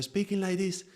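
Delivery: high-pitched. Shown as a demonstration. Teacher showing a change of pitch, high voice. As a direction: lift the whole phrase up high. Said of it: "changing the pitch of the voice".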